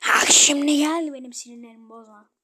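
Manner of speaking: furious shouted warning; loud threat. Real, not acted. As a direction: yell the threat at the bot.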